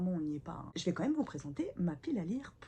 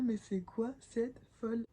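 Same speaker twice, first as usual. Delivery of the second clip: imagined viewers; 5 semitones higher, 3 dB louder than usual. Pretend. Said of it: "Les gens, ils vont se dire".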